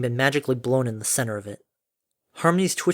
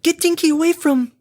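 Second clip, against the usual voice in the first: very high, loud, desperate order; snarling; 5 dB louder than usual. A narrator's reading. Fluttershy orders Dinky moved from danger, snarling. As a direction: shout it high, cut off before the end. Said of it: "Fluttershy literally snarled".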